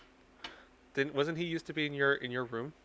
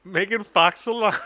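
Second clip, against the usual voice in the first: incredulous; 7 semitones higher, 10 dB louder than usual. Real, not acted. Incredulous repeat.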